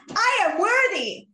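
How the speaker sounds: high, loud, pumped-up declaration; forced, over-energised affirmation; amped up. Shown as a demonstration. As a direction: hype it up like a cheerleader. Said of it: "that was more amped up".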